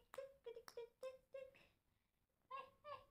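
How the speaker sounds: very high, soft tik tik; baby talk. Acted. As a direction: coo playfully at the child. (taas ug hinay nga tingog pambata)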